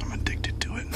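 soft, breathy whisper; nervous night vlog whisper. Real, not acted. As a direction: whisper it in the dark morgue. Whispering in the morgue.

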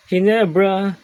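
high, loud team cheer; excited cheer. Real, not acted. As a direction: belt out the team name. (masayang sigaw para sa koponan)

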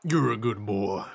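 a hint of a rough outlaw praising a pup; Arthur Morgan impression. Friend asks for his game cowboy impression, lightly voiced low drawl. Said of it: "You're Arthur Morgan, uh, imitation".

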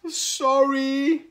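high, loud, squealed apology; panicky apology. A narrator's reading. Hippo apologises for another snap, panicky.

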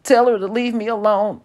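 on the verge of tears; voice breaking. A narrator's reading. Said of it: "His voice broke".

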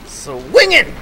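high, loud gleeful whoop; gleeful high call. Pretend. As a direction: cry it out with glee.

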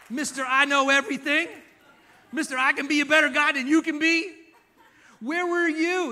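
mock him as a know-it-all; sarcastic God. God mocking Job as a know-it-all, loud and sarcastic. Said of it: "He gets really sarcastic".

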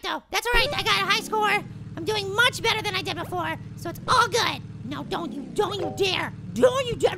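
normal talking voice

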